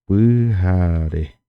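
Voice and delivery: pujare said slowly and carefully; slow, clear pujare with the whole sentence; Guarani word pujare. Shown as a demonstration. Teacher modelling the Guarani for night slowly. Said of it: "¿Cómo diríamos noche en guaraní?".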